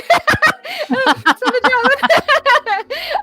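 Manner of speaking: long, high, loud laughing fit; real laughing fit. Real, not acted. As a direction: laugh helplessly. Players cracking up at table.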